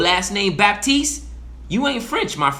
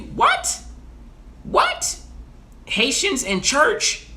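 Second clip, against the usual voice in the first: squeaky, much higher falsetto What, then a slow drawn-out question; hammed-up shock at an insult to Haitians and church; mock outrage; 11 semitones higher, much slower than usual. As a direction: play the scandalized churchgoer, shrieking high. Mimicking a shocked Haitian reaction.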